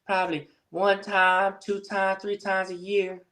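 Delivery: put-on high voice, mimicking someone; teasing voice impression. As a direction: imitate the streamer in a high put-on voice. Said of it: "That's my voice impression of you".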